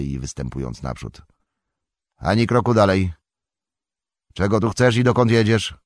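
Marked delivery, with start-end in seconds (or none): 2.2-5.7 loud, high warning to a stranger; stern challenge. Acted. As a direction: stand your ground and demand answers. Farmer challenges rider. (farmer staje przed jeźdźcem: surowe, głośne wezwanie)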